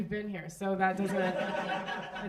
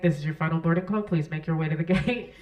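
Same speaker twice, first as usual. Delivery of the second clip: lightly voiced airline announcer, lower pitch; a touch of official gate-agent voice, pitched down; standard boarding announcement; 5 semitones lower, 5 dB louder than usual. Gate agent calls final boarding, mock-official announcer voice. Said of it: "getting on the intercom and being like".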